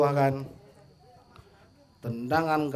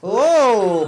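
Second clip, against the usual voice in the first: surprised exclamation; 8 semitones higher, 10 dB louder than usual. Real, not acted. Acrobatic attempt on goal.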